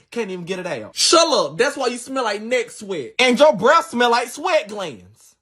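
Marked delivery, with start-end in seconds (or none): none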